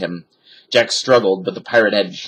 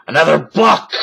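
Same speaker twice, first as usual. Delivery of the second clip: loud, higher pirate roar; captain roaring; 6 semitones higher, 5 dB louder than usual. A narrator's reading. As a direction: roar in disgust. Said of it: "roared Captain Bones".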